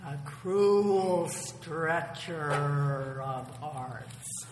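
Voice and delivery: slow, low, smiling wolf. Acted. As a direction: savour the words with a smile. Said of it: "smiled Wolf".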